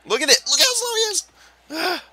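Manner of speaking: very high, strained complaint; exasperated squeal. Real, not acted. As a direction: squeal it in disbelief, far above your voice. Complaining about his slow player.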